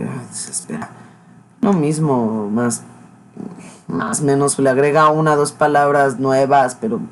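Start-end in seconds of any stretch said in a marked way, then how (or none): 0.0-0.9 a much softer, lower fed-up sigh; exasperated sigh. Real, not acted. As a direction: give up on him with a sigh. Annoyed by repetitive speaker. (suspiro de hartazgo, bajito)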